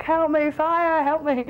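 slow high cry, dragged out; calling for help. Retold or imitated. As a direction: yell it down from the window, stretched long. Calling from the window.

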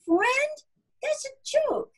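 very high, incredulous scoff; haughty ridicule; scoffing disbelief. A narrator's reading. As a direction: shoot up in pitch at the absurd idea. Haughty cow scoffs at friendship.

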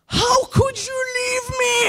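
high, strained yell of betrayal; a furious, cracking shout. Retold or imitated. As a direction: explode at her for quitting mid-game. Husband bursting in after rage quit.

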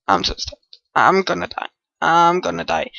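low, soft hopeless mumble; despairing mutter. Real, not acted. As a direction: mutter your doom. Trapped in-game.

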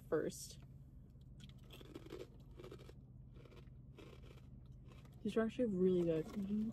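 talking while chewing food. Real, not acted. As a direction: mouth partly full of snack. Seen 1: Ate snack at -5; jaw chewing while holding bag until 2.0.

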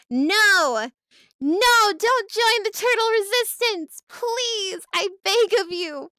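high, loud melodramatic plea; overacted pleading; hammed-up begging. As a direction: beg at full voice in mock despair. Begging viewer not to defect.

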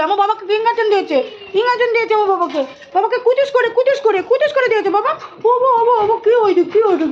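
put-on pet baby talk. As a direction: comfort the pup in a babyish lilt. Cooing to pets after vaccine.

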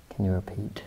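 soft whisper into the dark; whispering to a ghost. Real, not acted. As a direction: whisper a plea to the spirit. Whispered question to the spirit.

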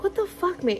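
high, startled what-the-fuck at the egg; baffled outburst. Retold or imitated. Mom swearing at an egg that won't crack, pitched high in disbelief.

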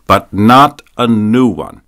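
slow, loud imperious order; commanding. A narrator's reading. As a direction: bark it as a command. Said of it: "she commanded".

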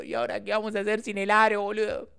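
high, hammed-up lament; mock-tragic mother. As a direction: imitate a mother wailing over a tragedy. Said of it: "era como una tragedia".

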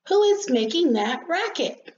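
high, indignant little rosebud; annoyed demand. Acted. Rosebud demands to know who is being noisy, annoyed.

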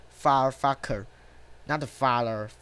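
low, soft grumble; muttered cursing. Real, not acted. Player grumbling at a far ball, muttered cursing. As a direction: curse quietly to yourself, pitch dropped. Player curses under breath at game.

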